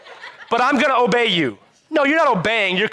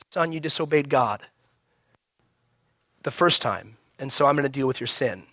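calm firm father; 5 semitones lower, 5 dB quieter than usual. Retold or imitated. Calm godly parent voiced.

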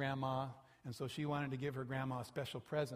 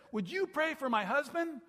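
eager request; 12 semitones higher, 8 dB louder than usual. Retold or imitated. Woman still excited.

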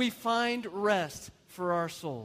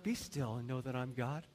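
low, soft, understated verse; meek, gentle reading; 8 semitones lower, 9 dB quieter than usual. Shown as a demonstration. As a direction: say it mildly, with no exclamation point at all.

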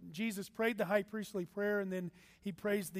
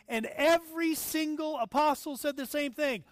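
emphatic; 7 semitones higher, 6 dB louder than usual. Real, not acted. Emphatic sermon point.

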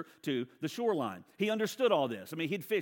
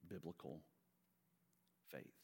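hushed emphasis; 7 semitones lower, 16 dB quieter than usual. Real, not acted. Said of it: "hushed pause".

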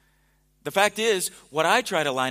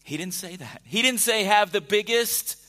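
louder, building preacher's list of worldly goals; rising sermon list; 4 dB louder, slower than usual. Acted. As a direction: pile up the list like a preacher gathering steam.

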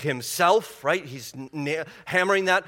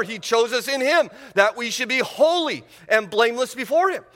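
raised, emphatic preaching voice, higher than before; preaching at full force; 7 semitones higher than usual. Acted. Preacher rallying the church, emphatic and raised. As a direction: drive the promise home to the congregation.

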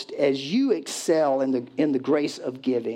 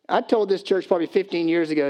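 usual speaking voice

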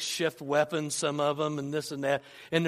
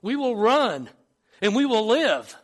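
acted protest, higher and louder; retold yell; 3 semitones higher, 5 dB louder than usual. Countryman refuses to fight the army, acted yell. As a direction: call it out like a doubter in the ranks. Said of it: "someone yells out".

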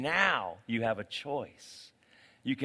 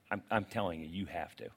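low earnest plea; 6 semitones lower, 5 dB quieter, faster than usual. Real, not acted.

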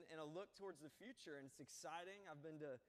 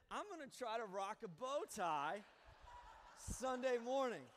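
excited; 7 semitones higher, 8 dB louder than usual. Real, not acted. Jokey bow tie story.

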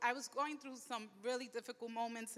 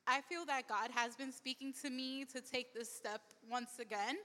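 ordinary delivery

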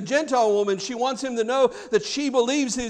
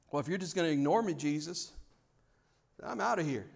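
low, soft acted giving up; quietly fed-up, muted exit line; resigned walking away; 6 semitones lower, 9 dB quieter, faster than usual. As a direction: drop the voice and quit on the prayer. Acts out giving up on Jesus.

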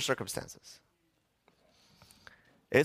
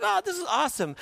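much higher gushing delight; mock someone thrilled with life; faster than usual. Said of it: "you're like".

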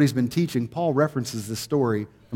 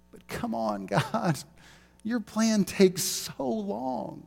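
much higher, slow, softer complaining; impatient whine; 10 semitones higher, 4 dB quieter, much slower than usual. Pretend. Believer complaining to God about the delay, whiny and slow. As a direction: drag it out like a fed-up child. Voicing impatience with God's plan.

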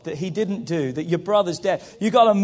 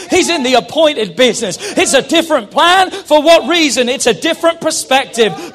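loud, emphatic preacher proclamation; full-force preaching; 3 semitones higher, 8 dB louder than usual. Real, not acted. Preacher telling the church Jesus is their resurrection, preaching loudly. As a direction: proclaim it hard to rouse the whole church.

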